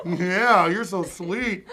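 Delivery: slow, drawn-out mock coo; hammed-up pet talk; acted cooing. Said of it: "all you can do is".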